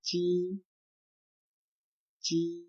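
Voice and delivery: "Ji" said high and clear; Chinese word Ji. Shown as a demonstration. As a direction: model "Ji" for learners. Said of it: "is known as".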